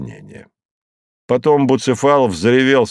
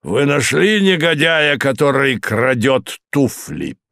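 gruff demand; 5 semitones higher than usual. A narrator's reading. Moses questioning host.

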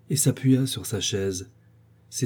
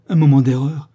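loud, incredulous question; indignant echo; 3 semitones higher, 9 dB louder than usual. A narrator's reading. Sophie repeats her lover's excuse, loudly indignant. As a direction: throw the excuse back at him.

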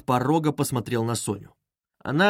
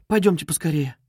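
mildly alarmed, higher-pitched plea; startled urging; 5 semitones higher than usual. A narrator's reading. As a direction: urge them on with a touch of alarm. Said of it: "вскинулась Соня".

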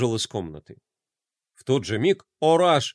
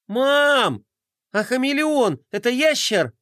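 higher, a call to the next room; calling loudly; 9 semitones higher than usual. Acted. Roma calls to mum in the next room, loudly. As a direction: call out to mum through the door. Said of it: "Рома громко позвал".

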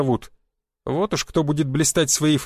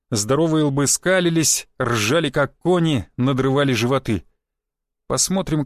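ordinary delivery, nothing marked

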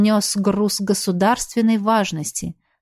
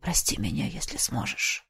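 much lower, soft whisper; muffled whisper; 9 semitones lower, 11 dB quieter than usual. Acted. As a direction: breathe it out muffled. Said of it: "глухо прошептал он".

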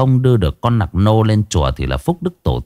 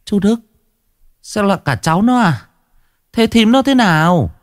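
put-on much higher old woman's voice; anxious old aunt; 11 semitones higher than usual. Aunt asking the old man about his wife, high and anxious. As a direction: ask urgently like a worried aunt.

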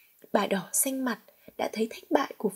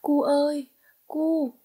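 put-on raised, louder call for a child; calling out loudly; 4 semitones higher, 4 dB louder, slower than usual. A narrator's reading. Mother calling her missing son in the garden, calling out. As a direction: call out across the garden, mildly strained. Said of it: "thi thoảng bà lại gân cổ gào to".

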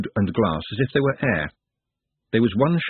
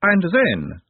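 high, leading prompt; encouraging teacher; 9 semitones higher than usual. A narrator's reading. Ladyship prompting a promising pupil, encouraging. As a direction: coax the answer out like a teacher. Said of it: "like a teacher encouraging a promising pupil".

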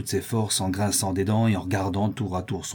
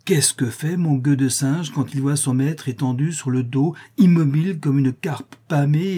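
ordinary delivery

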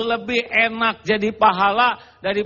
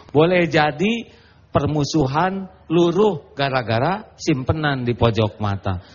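ordinary talk

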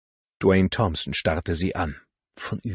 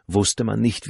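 ordinary delivery

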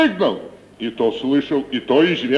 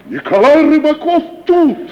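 excited exclaim; 10 semitones higher, 7 dB louder than usual. Acted. [name] recalls the great actor's arrival.

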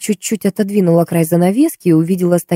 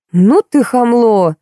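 blurted out; 6 semitones higher, 3 dB louder, much slower than usual. A narrator's reading. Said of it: "выпалила Марта".

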